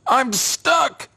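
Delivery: a silly trapped squeal; stuck scarecrow. Acted.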